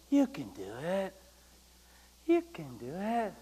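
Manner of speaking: soft encouragement. Retold or imitated. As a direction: coax it softly like a patient coach. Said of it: "After he goes".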